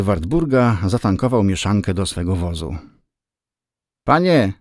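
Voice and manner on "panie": high-pitched falling call. A narrator's reading. Wartburg owner to the narrator on leaving, calling out. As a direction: call out for the man's attention. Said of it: "zawołał do mnie na odjezdnym".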